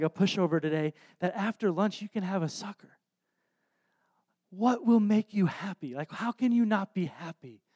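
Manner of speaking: soft, measured parental reasoning; really calm. Retold or imitated. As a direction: quietly reason with a small daughter, holding it together. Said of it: "I'm really calm".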